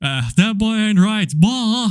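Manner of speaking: put-on Texan twang, drawn out; Hank Hill. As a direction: do a cartoon dad's Texas accent. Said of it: "that's my Hank Hill accent".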